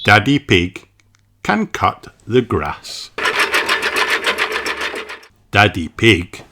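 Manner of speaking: ordinary delivery, nothing marked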